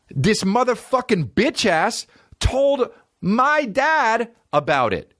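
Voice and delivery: high, slow indignant storytelling; mock outrage. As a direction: draw out the indignation, high-pitched. Indignant retelling of being told on.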